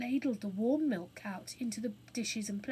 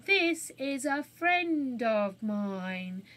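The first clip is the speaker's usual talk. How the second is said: slow, deliberate, knowing; girl introducing the burglar pointedly; pointed introduction; 6 dB louder, much slower than usual. Acted. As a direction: say it meaningfully to the boys.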